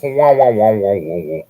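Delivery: descending "wah-wah" game-over noise; video game death sound. Retold or imitated. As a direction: mimic a retro game character dying. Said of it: "like, when your character dies".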